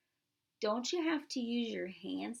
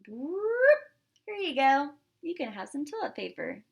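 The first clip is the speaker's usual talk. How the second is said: bright high voice handing over; cheerful offer to the sneezing mom; Maxine offering; 5 semitones higher, 7 dB louder than usual. Acted. Maxine handing toilet paper to her mom, cheerfully high. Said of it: "So Maxine's like".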